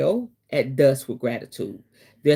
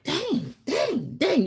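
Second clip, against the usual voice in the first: dramatic dismay; 11 semitones higher, 4 dB quieter, much slower than usual. Retold or imitated. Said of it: "Y'all know I'm just dramatic".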